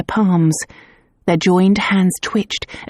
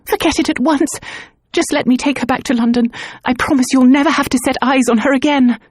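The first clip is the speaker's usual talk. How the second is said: high frantic pleading; frantic plea; 7 semitones higher than usual. Acted.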